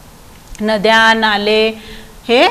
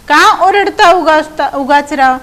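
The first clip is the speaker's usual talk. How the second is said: slow, raised verse line; poem recitation; 4 semitones higher, 4 dB louder, slower than usual. Shown as a demonstration. Teacher quoting the poem's question to pupils, slow recitation. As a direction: recite it higher, stretching the words.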